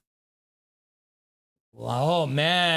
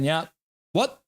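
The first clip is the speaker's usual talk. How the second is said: ordinary talk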